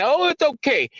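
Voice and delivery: high mocking voice of libertarians; mocking quote. Mocking the libertarians' dismissive tone. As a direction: sneer it back at them, high. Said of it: "The libertarians sit there and say".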